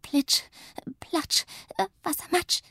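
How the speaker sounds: very high, hurried incantation; frantic spell. A narrator's reading. Little witch panics and shrieks the wrong spell, very high. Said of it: "schreit sie".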